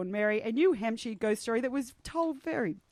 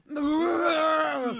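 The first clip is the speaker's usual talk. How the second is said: mock retching; 4 semitones higher than usual. Listener miming forced to heave, exaggerated retching. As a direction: heave noisily and at length. Acted heaving, misread as vomiting.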